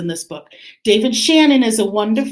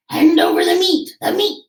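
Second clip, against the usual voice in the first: roaring crew; 7 semitones higher than usual. Acted. Pirates demand meat at dinner, high mock roar. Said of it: "we all roared".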